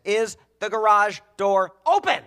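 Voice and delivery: loud, high, emphatic question; alarmed shouting. Retold or imitated.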